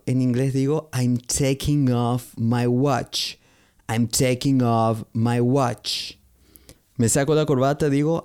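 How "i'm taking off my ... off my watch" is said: slow, careful model sentence; slow teaching demo. Shown as a demonstration. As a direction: say it slowly so learners can copy. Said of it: "teacher models English phrases".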